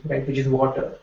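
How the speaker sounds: ordinary talk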